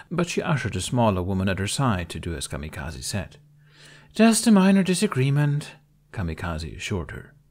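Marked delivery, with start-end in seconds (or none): 4.1-5.8 everything is fine. Acted. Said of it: "a rather convincing "everything is just fine" voice".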